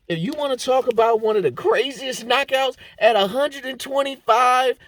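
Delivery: crowd-pumping excitement, words stretched; hyped up. Real, not acted. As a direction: sell it like the knockout of the year. Hyping the spinning elbow knockout.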